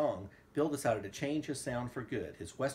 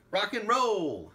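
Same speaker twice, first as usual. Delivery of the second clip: excited exclaim; 10 semitones higher, 8 dB louder, slower than usual. Acted. Reading book aloud, exclaiming title.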